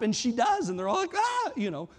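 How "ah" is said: high, startled cry; short high-pitched "ah"; mock gasp of shock. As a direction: imitate an astonished crowd's yelp. Crowd's mock astonishment, retold.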